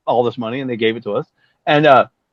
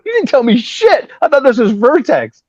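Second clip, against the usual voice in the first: high, raised-voice protest; retold indignation; 10 semitones higher, 4 dB louder than usual. Bassist telling Steven he was never told, indignant. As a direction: act out an incredulous comeback. Indignant retort about being misled.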